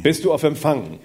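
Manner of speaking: lightly put-on voice of God; Charlton Heston as God. Interpreter copies God checking for reception, mildly mock-grand voice. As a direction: call down with a hint of epic grandeur. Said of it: "Gott redet wie Charlton Heston".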